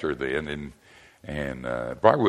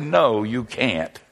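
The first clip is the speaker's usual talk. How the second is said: flat refusal; 3 semitones higher, much slower than usual. Retold or imitated. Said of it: "And I said".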